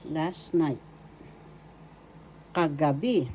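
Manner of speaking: usual speaking voice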